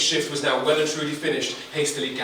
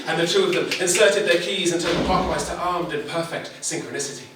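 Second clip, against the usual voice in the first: ordinary delivery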